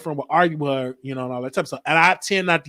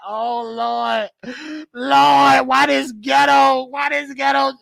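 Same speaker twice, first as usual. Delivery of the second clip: higher, exclaiming to himself in mock dismay; embarrassed exasperation; 9 semitones higher, much slower than usual. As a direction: groan at the embarrassing scene, half-shouting. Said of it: "'Cause I was so embarrassed".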